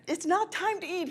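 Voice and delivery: high, firm reply to the dog; high mock scolding. Owner answers dog.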